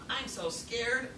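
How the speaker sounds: ordinary delivery, nothing marked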